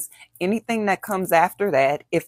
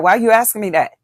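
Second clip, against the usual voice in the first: sharp, raised defensive comeback; 4 semitones higher, 8 dB louder, much faster than usual. Retold or imitated. A person being questioned snaps back defensively. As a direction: push back as if caught off guard. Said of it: "if they get defensive and be like".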